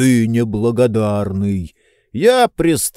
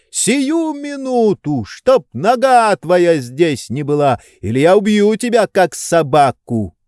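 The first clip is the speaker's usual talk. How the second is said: furious threat; 7 semitones higher than usual. A narrator's reading. Oblomov threatening Tarantyev.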